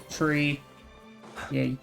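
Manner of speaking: normal talking voice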